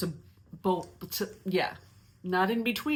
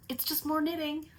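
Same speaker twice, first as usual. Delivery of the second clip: much higher, sing-song fake cheer; mock chipper voice; 13 semitones higher than usual. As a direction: talk yourself into it in a silly high voice. Mock-cheerful consolation to herself.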